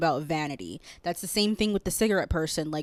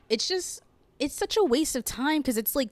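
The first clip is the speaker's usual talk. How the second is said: choked up; 7 semitones higher than usual. Real, not acted. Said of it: "why am I getting so choked up?".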